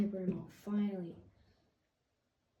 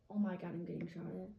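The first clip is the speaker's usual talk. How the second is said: usual speaking voice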